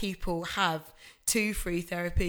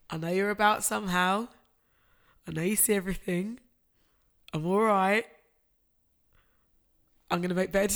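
fighting tears. Real, not acted. Daughter speaking to her late dad, tearful and tender. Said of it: "really try not to cry".

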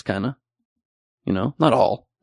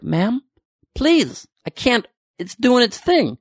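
high, slow pleading; exasperated plea; 11 semitones higher, slower than usual. Retold or imitated. Comic retelling pleading with a stewardess, high and exasperated. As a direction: beg her, strained and exasperated.

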